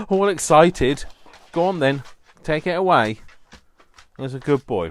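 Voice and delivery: high, loud doggy praise voice; talking to the dog. Real, not acted. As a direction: cheer the pup on brightly.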